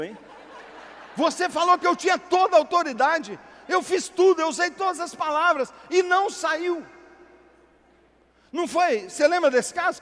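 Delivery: loud, high, fervent pastor's call; fiery preaching. Acted. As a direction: preach it hard to the congregation. Preaching at full force, loud.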